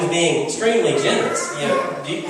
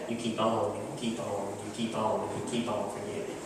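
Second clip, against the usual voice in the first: soft repeated urging; 4 semitones lower, 9 dB quieter than usual. Acted. Jesus telling Peter to keep forgiving, soft and fading. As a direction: keep on said softer each time, dropping away.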